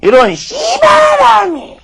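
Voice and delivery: high, slow shout in a Gyeongsang accent; Gyeongsang dialect curse. Shown as a demonstration. Lecturer demos the Gyeongsang curse to the audience, slow high Gyeongsang-accent shout. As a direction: shout it Gyeongsang style, drawn out. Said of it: "바로 이렇게 쓰입니다".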